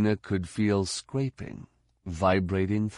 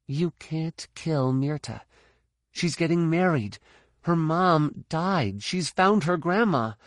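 inner voice; 8 semitones higher than usual. Acted. Inner voice pleading during fight.

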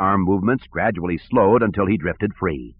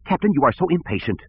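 petulant snap, higher pitched; snappish director; 7 semitones higher than usual. Acted. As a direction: snap at him peevishly. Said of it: "Sivron snapped".

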